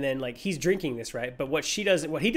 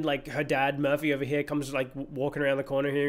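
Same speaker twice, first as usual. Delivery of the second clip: ordinary talk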